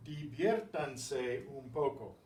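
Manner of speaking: slow, each syllable of diviértanse un poco laid out; slow clear model. Shown as a demonstration. Teacher modeling the command for the class, slow and clear. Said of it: "teacher models the command".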